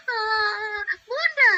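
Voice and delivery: high tearful realization; crying child. Acted. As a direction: break down remembering mother. (anak kecil menangis menyesal)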